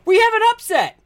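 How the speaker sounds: high, sudden upset call; hammed-up commentator shout; excited announcer. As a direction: cry out the shock result to the arena. Announcer reacts to dice result.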